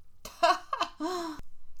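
high, light laugh; real high-pitched chuckle. Real, not acted. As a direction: laugh at your own cheeky remark. Laughs after "sorry, not sorry.

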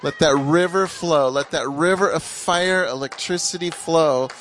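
fervent prayer. Real, not acted. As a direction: cry out to heaven for the glory to fall. Prays over David at full force.

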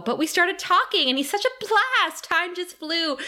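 high-pitched, mock-gushing retelling; gushing roommate. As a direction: imitate a roommate gushing about a boy. Gushing roommate voiced in recap.

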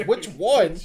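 Which one? thrown back high and loud, stress on one; mock the words Which one? in loud disbelief; outraged echo. Friend repeating the guy's answer Which one? in loud disbelief.

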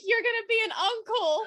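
telling him he's going to be an uncle, thrilled; excited quote. Retold or imitated. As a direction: squeal the news with delight. Voices character's line, excited.

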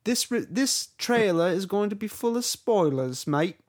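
high, loud fake Cockney; Tom Holland. British actor warning of trailer spoilers, bad Cockney. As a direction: stumble through a hammed-up British warning. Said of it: "Tom Holland comes on".

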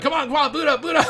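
high, loud, impatient chant; comically frantic pleading, laughing at the end; frantic urging. Retold or imitated. Urging the computer in retold rush.

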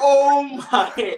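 high, loud retold gasp of disbelief; laughing, high-pitched astonishment; starstruck shock. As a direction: re-enact being floored by a famous caller. Retold shock at the star's call.

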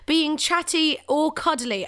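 high-pitched, drawn-out disbelief; playfully affronted retort; mock incredulous. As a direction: spell out the rhetorical question, slowly and pointedly. Retold incredulous reply to friends.

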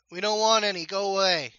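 gruff shout through a door; muffled gruff voice. Acted. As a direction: bark it from behind a closed door. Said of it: "a muffled voice from the inside".

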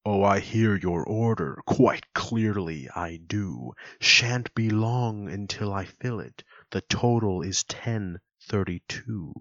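slow, theatrical big tough guy; mock-Shakespearean reply from a tough cashier; stately, drawn-out stage voice; Shakespearean counter guy. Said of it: "He's kinda big and tough".

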